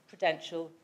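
usual speaking voice

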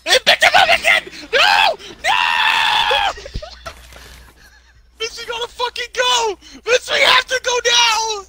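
high frantic shouting; panicked yell. Real, not acted. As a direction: scream for your partner to run. Gamer panicking, partner grabbed.

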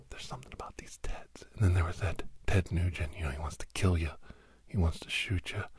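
normal talking voice

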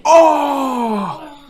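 sharp high cry of pain; pained yelp. Real, not acted. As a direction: yelp like you got hit. Hit in the eye.

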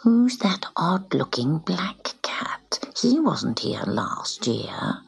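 slow, soft gossiping whisper. Acted. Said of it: "The other cats began to whisper".

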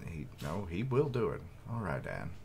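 plain reading voice

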